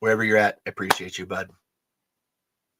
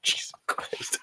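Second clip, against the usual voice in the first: whispered oath; 12 dB quieter than usual. Real, not acted. Reacting to comic page.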